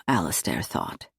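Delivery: usual speaking voice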